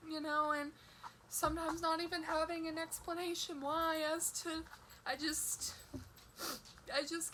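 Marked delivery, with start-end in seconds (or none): none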